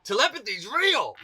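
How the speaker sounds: high, stretched-out wonder; reliving a mind-blown moment, slow and high; amazed realisation. Retold or imitated. Dancer realising his thought was heard, high drawn-out amazement.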